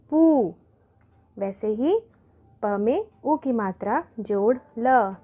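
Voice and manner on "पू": पू called out higher and louder; teacher's model answer. Shown as a demonstration. Teacher to pupils, reading पू out loud. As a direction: announce पू as the answer, bright and loud. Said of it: "तो हम पढ़ेंगे".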